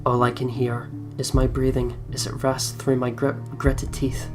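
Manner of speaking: ordinary talk, nothing marked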